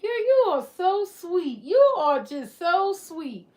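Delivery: high, slow, sugary praise; gushing admirer. Retold or imitated. People gushing at her, high and drawn out. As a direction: lay the compliments on thick. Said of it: "They be like".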